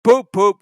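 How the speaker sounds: engine whistle. Acted. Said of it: "he whistled".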